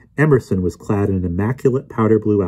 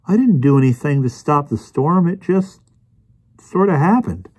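higher-pitched, modest protest; Anthony's character voice; 8 semitones higher than usual. Acted. Anthony protests he did nothing.

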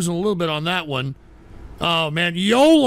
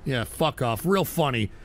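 low, quiet, unamused brush-off; dry sarcasm; 8 semitones lower, 7 dB quieter than usual. Real, not acted. Streamer unimpressed by a viewer's joke, dry low dismissal.

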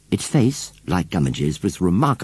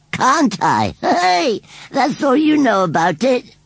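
loud, very high defiant crowing; 5 dB louder, slower than usual. A narrator's reading. Scarecrow scoffs at the children's doubts, defiant. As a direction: fling it back, scorning what they know. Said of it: "said Gummidge defiantly".

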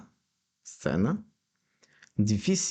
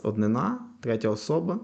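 ordinary delivery

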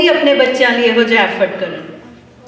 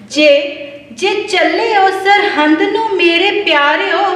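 half-sung verse, each line repeated higher; devotional poem recitation; 3 semitones higher, slower than usual. Acted. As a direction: chant it like a gurdwara poem, lifting the repeats.

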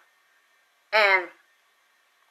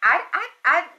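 shocked stammer; 7 semitones higher, faster than usual. Retold or imitated.

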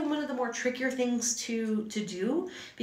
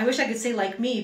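usual speaking voice

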